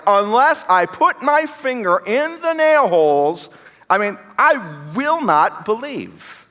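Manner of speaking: high, slow, emphatic refusal; defiant skeptic. Acted. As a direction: lay down the condition word by word. Voicing Thomas's defiant refusal.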